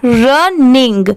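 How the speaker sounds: Running said slowly, syllable by syllable; slow running. Shown as a demonstration. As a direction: stretch out running slowly like a teacher. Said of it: "इसे speed में पढ़ेंगे". (Running धीरे-धीरे, अलग-अलग करके)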